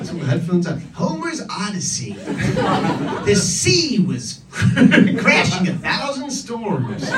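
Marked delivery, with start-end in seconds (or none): 0.9-7.0 put-on American accent. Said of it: "the narrator was, like, American".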